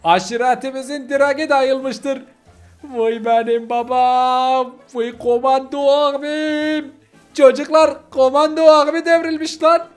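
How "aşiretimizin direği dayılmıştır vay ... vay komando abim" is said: drawn-out, high-pitched mourning wail; theatrical lament. Acted. Follower mourns his fallen commando brother, theatrical lament. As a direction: lament like a mock funeral mourner.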